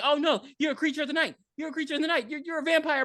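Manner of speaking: high-pitched, dramatic accusation; mock alarm. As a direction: act out a brother recoiling from a vampire. Mock brother accusing vampire sibling.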